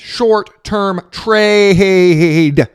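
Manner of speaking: "Short-term trade" dragged out very slowly and loud. Shown as a demonstration. Hammering the point home to viewers, very slow and loud. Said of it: "Do I have to make my face bigger so you guys understand?".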